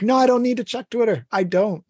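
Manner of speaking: acted-out firm refusal to yourself; self-correcting. Answering own urge to check.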